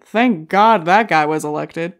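mock relief. Quoting audience relief.

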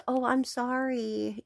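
mock a customer pretending to be sorry; fake apology. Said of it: "after faking an apology".

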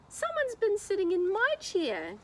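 put-on high wailing baby bear; Baby Bear crying. A narrator's reading. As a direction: cry it out like a little upset bear. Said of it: "cried Baby Bear".

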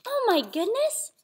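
high, breathy wonder; playful hushed amazement for little listeners; amazed gasp. Pretend. Reacting to the story for children.

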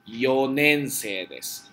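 "Yonense desu" said slowly, yo with no n before nense; slow classroom demo; slow correct form. Shown as a demonstration. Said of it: "aquí no es yon, sino tiene que ser yo".

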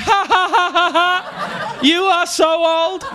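high gleeful jeering cackle; mocking laugh. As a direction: laugh at him like a gloating ten-year-old. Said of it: "And I was like".

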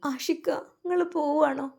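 put-on high, soft little-girl plea; begging him not to go; tearful plea. Little sister asking her departing brother, high and tearful.

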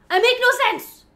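high, loud outburst; exclaiming. Real, not acted. Vlogger confessing she talks nonsense, high loud exclamation. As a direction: burst out with it suddenly. Same admission, suddenly exclaimed.

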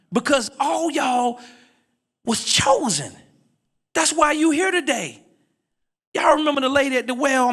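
high-pitched, building preacher's call to the crowd; full preaching. Acted. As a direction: preach it out to the congregation, rising.

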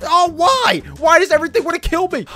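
high-pitched cry of dismay; why-me outburst; exasperated wail. Real, not acted. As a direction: complain loudly in panic. Attacked by yet another creature.

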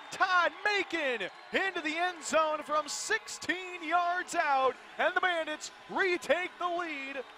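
high, loud stadium shout; touchdown call. Real, not acted. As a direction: yell the touchdown, then the score.

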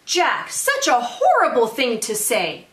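lively, singsong storytelling; picture-book read-aloud. A narrator's reading. Teacher reads a zombie picture book to families, animated read-aloud. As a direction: read it to children with rhythmic emphasis. Reads dialogue of boy and mother.

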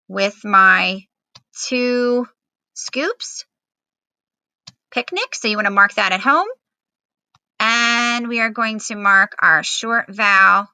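ordinary delivery

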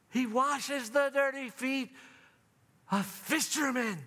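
high, slow, proclaiming; exalted preaching. Acted. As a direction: lift the title up like praise.

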